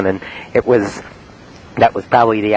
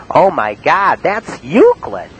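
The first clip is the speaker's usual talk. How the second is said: astonished; 7 semitones higher, slower than usual. Retold or imitated. Astonished operator quote.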